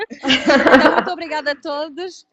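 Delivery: laughing through her thank-you. Real, not acted. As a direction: thank everyone while laughing. (agradecendo entre risos)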